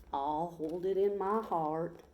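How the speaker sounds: slow, easy Texas cowboy drawl; a lazy Texas drawl, unhurried. Acted. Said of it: "in his slow Texas drawl".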